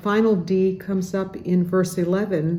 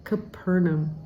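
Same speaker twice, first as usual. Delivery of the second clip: "Capernaum" as ca-PER-naum, the second syllable stressed. Shown as a demonstration. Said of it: "put the emphasis on the second syllable".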